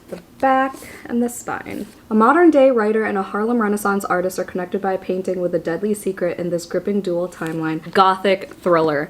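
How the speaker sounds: ordinary talk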